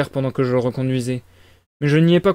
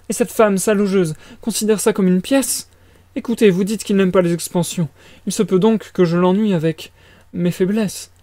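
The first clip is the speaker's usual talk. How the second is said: high-pitched flustered mother; worried mama in a raised, agitated voice; fretful mother character; 5 semitones higher than usual. Acted.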